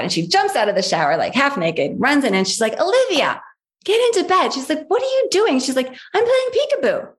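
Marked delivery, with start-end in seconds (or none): 2.8-4.5 mother scolding. Retold or imitated. Mother voiced scolding child. 4.9-5.6 puzzled mother. Retold or imitated. 5.9-7.1 put-on much higher little-child voice; toddler answering.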